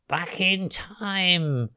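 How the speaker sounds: high, slow, drawn-out witch voice; ominous witch. A narrator's reading. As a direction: stretch the words out with menace. Witch threatens to send them back.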